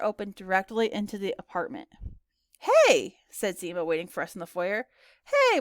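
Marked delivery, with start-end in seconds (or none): 2.6-3.2 high, loud hey; bright-pitched, punchy hello; cheerful greeting. Acted. Girl greets guests at door. 5.2-5.6 high-pitched, loud hey; a high, punchy hello; cheerful greeting back. Acted. Friends answer the greeting.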